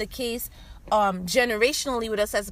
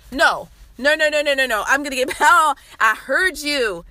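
louder, insistent, with a laugh breaking in; loud playful objection; 2 semitones higher, 7 dB louder than usual. Real, not acted. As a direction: cut off the objector loudly. Calls out an imagined objecting listener.

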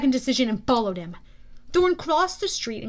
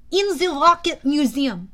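put-on louder, higher French-accented answer; 4 semitones higher, 7 dB louder than usual. A narrator's reading. Thorne answering the booming general, French accent. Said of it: "spoke in his weird French accent".

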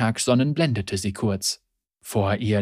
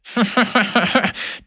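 bitter laugh; 8 semitones higher than usual. Acted. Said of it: "Der Mann lachte erbittert".

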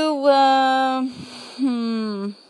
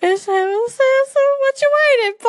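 cartoon voice; 13 semitones higher, 5 dB louder than usual. Shown as a demonstration. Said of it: "continuing cartoon voice".

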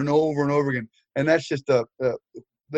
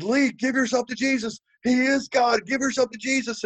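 calling out high-pitched down the hall; calling after him; 10 semitones higher than usual. Retold or imitated. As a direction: plead after him as he's led away.

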